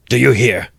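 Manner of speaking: shouted. A narrator's reading. Raskolnikov pounding the table, shouting. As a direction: bang the fist and yell it. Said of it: "he shouted".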